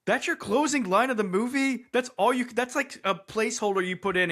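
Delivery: high, incredulous outburst; can't-believe-it reaction, high and pressed; acted disbelief. Acts out disbelief at ending.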